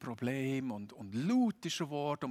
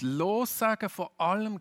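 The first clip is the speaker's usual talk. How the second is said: Lossagen von allem called out high and loud; louder, higher preached Lossagen von allem; emphatic and loud; 8 semitones higher, 7 dB louder than usual. Shown as a demonstration. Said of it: "ein Thema, das sich in der ganzen Bibel immer wieder durchzieht".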